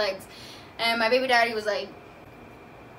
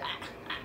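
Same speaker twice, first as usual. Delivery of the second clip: breathy unvoiced gasps of pain; whispered pained gasps; 12 dB quieter than usual. Mother mimics gasping as the doctor scrapes, whispered gasps. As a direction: gasp softly as it hurts. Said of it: "I was just like".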